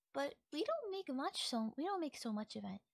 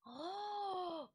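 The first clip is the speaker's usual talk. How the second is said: excited gasp; 6 semitones higher, 6 dB quieter than usual. Acted. Sudden excitement over ASMR.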